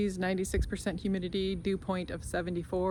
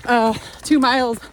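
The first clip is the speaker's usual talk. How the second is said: while running; 5 semitones higher, 13 dB louder than usual. Real, not acted. Mid-run update.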